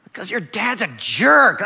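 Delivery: hammed-up loud, rising confession; exasperated outburst. Blurts answer to son, hammed up.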